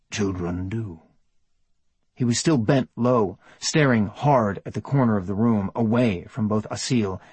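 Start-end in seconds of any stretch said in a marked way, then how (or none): 0.1-1.0 low, hushed and unsure; barely whispered, apologetic; soft tentative whisper. A narrator's reading. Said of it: "a soft whisper, apologetic and tentative".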